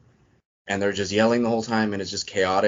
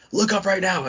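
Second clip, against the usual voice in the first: a much higher barked order; mock drill instructor yell; 11 semitones higher than usual. Drill instructor ordering recruits to look up, mock yell. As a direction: snap it at the recruits like a drill sergeant.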